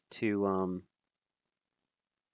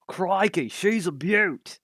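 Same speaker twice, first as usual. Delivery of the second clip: high, slow, hammed-up Australian accent; a deliberately terrible Aussie drawl, pitched up; bad Australian accent, high and slow; Crocodile Hunter Aussie accent; 9 semitones higher, 5 dB louder, slower than usual. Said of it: "a terrible Crocodile Hunter impression".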